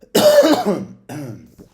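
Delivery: a loud, harsh cough; real cough. Real, not acted. Reader coughing mid-story, loud harsh cough. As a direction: cough hard mid-reading.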